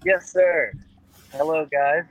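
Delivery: ordinary talk, nothing marked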